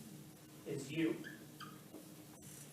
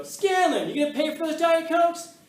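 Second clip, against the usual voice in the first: loud, high shout down a hallway; yelling out; 13 semitones higher, 13 dB louder, slower than usual. Retold or imitated. Soda's owner calling out teacher, loud accusing yell. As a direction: imitate an accuser hollering after someone.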